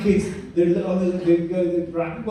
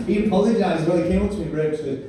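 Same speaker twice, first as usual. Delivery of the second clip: normal talking voice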